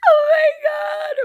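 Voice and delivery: high, loud, stretched-out happy wail; hammed-up crying shriek; imitated bawling. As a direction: re-enact bawling over a big win. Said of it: "I'm, like, bawling".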